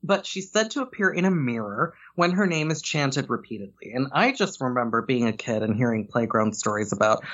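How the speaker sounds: ordinary delivery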